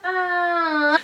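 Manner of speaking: sudden high-pitched shriek; short scream. Retold or imitated. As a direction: yelp out in fright. Scared scream in ghost story.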